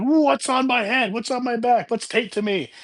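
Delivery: panicked yelling. Retold or imitated. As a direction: imitate a man frantically asking what's on him. Panicked Doug running with boar.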